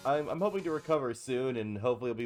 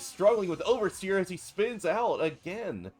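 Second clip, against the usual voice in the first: excited; 6 semitones higher, 5 dB louder than usual. Real, not acted. Commentator sees driver spin.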